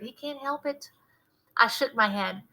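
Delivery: usual speaking voice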